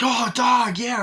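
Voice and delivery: high, loud startled cry; scared yelp. Retold or imitated. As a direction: yelp at the dog in alarm. Kids spot the junkyard dog.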